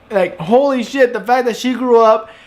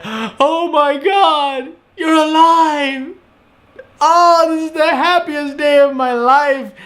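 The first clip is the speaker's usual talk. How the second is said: high, drawn-out imitation of a happy weeper; overjoyed, hammed-up crying voice; mock tearful joy; 7 semitones higher, slower than usual. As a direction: act out being overwhelmed to tears with relief. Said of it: "he's still crying the whole way through".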